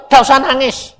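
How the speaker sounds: loud, high retold order; God's command. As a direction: issue it forcefully as God's word. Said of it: "Tuhan ini bilang".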